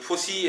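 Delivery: "फोसी" with a soft s; "फोसी" ending in a see sound; alternative pronunciation. Shown as a demonstration. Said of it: "ऐसे बोलते हैं".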